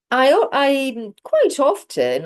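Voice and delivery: quite often said high, loud and slow; marking quite often as the point; stress on quite often. Shown as a demonstration. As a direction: lean hard on quite often. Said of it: "here you are specifying".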